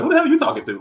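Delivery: high, sharp challenge flung back; retorting at a man who stepped to him; confrontational mode. Retold or imitated. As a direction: square up and fire the question. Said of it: "I would naturally go into the mode".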